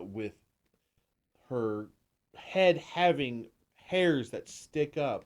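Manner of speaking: usual speaking voice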